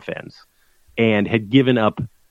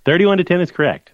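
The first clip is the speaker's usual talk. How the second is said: higher, bright game-show confirmation of the answer; mock quiz host; 6 semitones higher than usual. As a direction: announce the right answer like a lightly hammed-up quiz host. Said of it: "this is a little quiz here".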